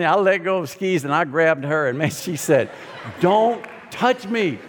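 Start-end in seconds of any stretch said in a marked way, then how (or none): 3.2-4.6 slow, higher "Don't touch me", a mock stern patrol girl; a hint of a tough ski patroller laying down the law; drawn-out mock scolding; stern order.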